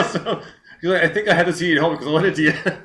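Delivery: high, quick words through laughter; laughing. Real, not acted. Host finishes the singer's anecdote, laughing. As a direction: still cracking up while quoting.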